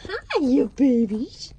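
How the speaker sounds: slow, drawn-out pet coo. Real, not acted. Woman cooing hello to her chickens, slow and sing-song.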